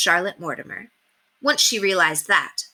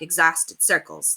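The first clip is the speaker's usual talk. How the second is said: ordinary delivery, nothing marked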